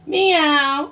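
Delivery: high loud meow; playful meow. Acted. Cat answers Duck with a high, loud meow.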